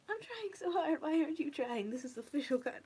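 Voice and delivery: playful, high-pitched protest at an unfairness; mock exasperation. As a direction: complain loudly, half in jest. Mock complaint at the new series.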